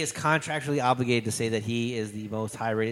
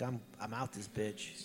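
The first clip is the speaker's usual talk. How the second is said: slow, quiet mock exit line; mock slipping away; 12 dB quieter, slower than usual. Host voices the lone woman leaving, quiet and slow. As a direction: drop it soft and slow, trailing away. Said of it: "the fucking lone woman is like".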